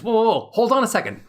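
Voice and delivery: the brain perking up, fast and keen; excited brain voice. Pretend. The amygdala catching the message, excited and fast. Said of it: "the amygdala says".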